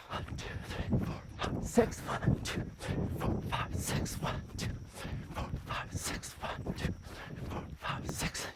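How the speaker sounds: breathy whispered count in rhythm. Shown as a demonstration. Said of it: "You got it?".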